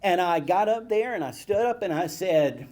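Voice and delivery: plain reading voice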